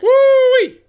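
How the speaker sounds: very high, very loud whoop; hype shout. Acted. Hype for fake soda.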